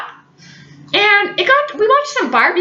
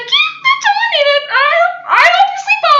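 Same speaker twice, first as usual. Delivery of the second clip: much higher, shaky, timid voice; frightened squeak; 4 dB louder than usual. Retold or imitated. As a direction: hurry to get off the phone, scared. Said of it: "slight terror in her voice".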